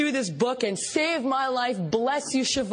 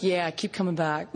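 dismissive sponsor; 7 semitones lower than usual. Retold or imitated. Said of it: "She's like".

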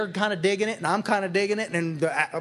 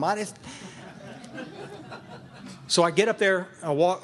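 ordinary delivery

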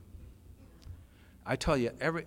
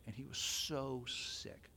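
hushed whisper; 12 dB quieter, much slower than usual. Real, not acted. Remembering the sick drunk.

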